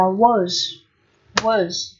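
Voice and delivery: "words" as weak "wəz", short schwa, no R or D; weak form. Shown as a demonstration. Teacher showing the light reading, weak short schwa in "words". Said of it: "đọc là âm ơ ngắn".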